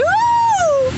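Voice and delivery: high thrilled whoop; excited whoop. Real, not acted. Tourist whooping as the cable car climbs, thrilled squeal. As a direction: squeal with glee as the car lifts off.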